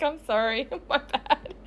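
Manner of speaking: soft, giggly mock apology; laughing apology. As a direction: own up sheepishly through laughter. Laughing apology to scolding friend.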